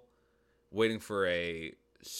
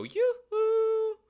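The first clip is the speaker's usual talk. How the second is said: very high, loud sing-song call of "Yoo-hoo"; high-pitched yoo-hoo; 6 dB louder than usual. Shown as a demonstration. Advice-show host demonstrating a flirty call, very high and loud. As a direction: trill "Yoo-hoo" way up high to catch a man's eye. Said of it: "you're gonna say it in a higher pitched tone than I say it".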